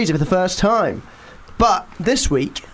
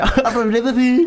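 higher, mocking Liverpool accent; mock Scouse; 6 semitones higher than usual. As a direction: imitate someone's stock Scouse line. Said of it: "That's what they all say".

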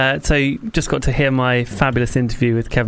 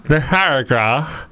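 mouth held open; slower than usual. Real, not acted. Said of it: "the mouth is wide open".

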